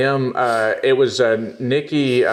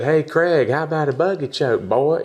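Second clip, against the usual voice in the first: put-on country accent taunt; 6 semitones higher than usual. Taunting Craig Jones online, teasing country accent. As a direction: taunt him in a country accent, a bit higher. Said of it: "They love my country accent".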